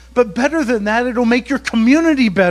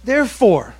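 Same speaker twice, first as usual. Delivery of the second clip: ordinary talk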